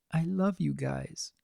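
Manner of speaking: whispered. Acted. Aunt whispering love to the twins, soft and slow. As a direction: hold them close and murmur it. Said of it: "she whispered to the two".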